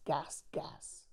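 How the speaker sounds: breathy whispered gas, gas; whispered refrain. Acted. Jack ends his own lyrics, fading into a whisper. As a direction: let the last gas, gas die to a whisper. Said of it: "you've made this your own".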